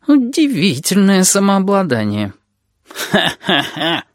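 slow, high-pitched mock admiration, dry ha-ha; gloomy sarcasm. A narrator's reading. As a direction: draw out the praise, then laugh without joy. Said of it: "мрачно подал признаки жизни ящер". (мрачная ирония, деланный смешок)

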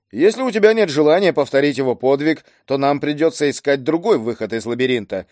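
usual speaking voice

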